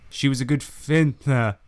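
ordinary delivery

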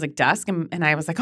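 normal talking voice

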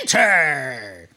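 a hint of a pompous little king; kingly command. Spazlatrash calls the party in, a hint of a pompous command. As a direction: call them in like a tiny monarch. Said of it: "He's like".

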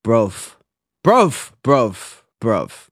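mock British slang, each word on its own; playful British accent, word by word; put-on British accent. Shown as a demonstration. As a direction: try out a British accent at changing pitches. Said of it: "That's my accent".

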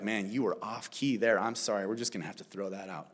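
low, soft, deadpan rejection; mock dismissal. God imagined rejecting off-key worship, mock dismissal, low and soft. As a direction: pretend to let them down, deadpan. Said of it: "He doesn't take our worship and be like".

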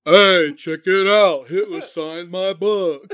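slow, high drawling Southern voice; loud, slow Southern drawl; Southern accent. Retold or imitated. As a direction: play the dopey Southern hero, slow and loud. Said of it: "He's becoming Southern now".

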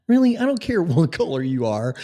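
high loud incredulous Really?; real outraged yelp. Real, not acted. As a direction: fling it out in outrage. Outraged disbelief.

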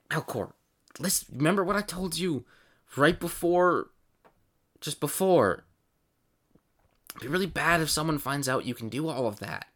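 lightly voiced cautioning character. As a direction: warn them, pausing between phrases. Game master voices worried figure.

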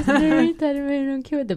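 high-pitched mock indignation; acted protest. Retold protest over her rifle pillow.

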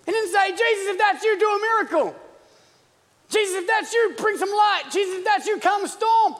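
high, loud shouted sermon; full-force preaching. Acted. Preacher listing what Peter never asked, full-force preaching. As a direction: shout each mock request at the same pitch.

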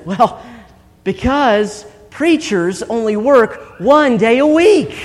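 retold childlike pride, drawn out for the punchline; proud child. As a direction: imitate a boy proudly delivering his answer. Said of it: "David very proudly says".

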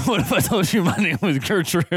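giggling through the words; cracking up mid-sentence; laughing. Real, not acted. GM breaking up laughing.

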